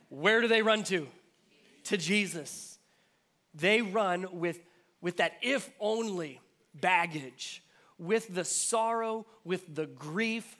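loud, slow, insistent preaching that drives each phrase home; preaching at full force. Acted. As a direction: lean in and preach it to the crowd. Preacher building to a climax.

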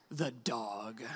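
very low, hushed deadpan; deadpan reveal. Retold or imitated. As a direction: drop it quietly after a long pause.

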